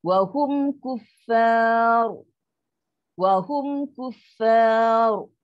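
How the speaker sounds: drawn-out tajwid Wa hum kuffar; slow loud recitation. Shown as a demonstration. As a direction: chant Wa hum kuffar slowly, ra heavy. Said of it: "kalau contoh macam ni".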